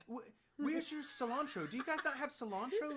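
high-pitched, insistent question with a laugh in it; baffled questioning. Retold or imitated. Asking the Tesco lady for cilantro, baffled.

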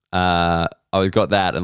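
ordinary talk, nothing marked

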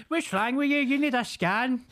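put-on very high, loud alarmed old woman; shrill panicky concern, hammed-up; mock fussing neighbour. Imitating the neighbour fretting at him on the phone, very high. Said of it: "Like, I could be on the phone".

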